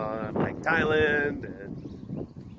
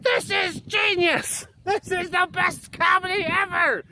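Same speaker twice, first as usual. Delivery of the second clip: put-on much higher, slow delighted kid voice; kids cheering. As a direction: gush like a thrilled child. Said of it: "the kids are just like".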